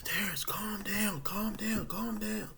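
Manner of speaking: high, slow, hushed calm-down; soothing paramedics. Retold or imitated. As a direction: gently settle a scared patient. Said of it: "they was like".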